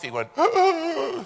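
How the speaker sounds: sharp high gasp of panic; hammed-up frightened gasp; mock terrified gasp. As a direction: gasp like he's seeing death. Friend terrified at takeoff.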